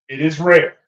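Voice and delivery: ordinary talk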